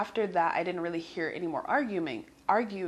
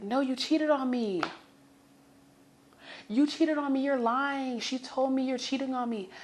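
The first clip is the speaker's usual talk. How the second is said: mock accusing yell; 7 semitones higher than usual. Girlfriend accuses him, retold.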